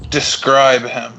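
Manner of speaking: slow, terse command; deliberate in-character demand; stern cleric interrogating. Acted. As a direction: order the witness with measured authority. Cleric questions the boy.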